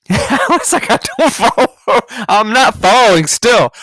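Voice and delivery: high, drawn-out words through laughter; laughing. Real, not acted. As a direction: laugh helplessly while retelling it. Laughing while retelling own reply.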